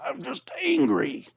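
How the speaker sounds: very high, slow, whiny retelling; upset outburst. Retold or imitated. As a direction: re-enact a strained, wailing protest. Retold outburst at the pastor's question.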